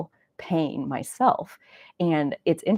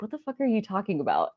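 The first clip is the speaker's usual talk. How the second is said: incredulous brothers; 5 semitones higher, 4 dB quieter, faster than usual. Retold or imitated. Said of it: "Jake and Sam who were like".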